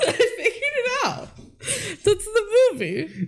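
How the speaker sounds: words squeezed out through laughter; giggling punchline; laughing. Real, not acted. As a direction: say it while cracking up. Joking while laughing.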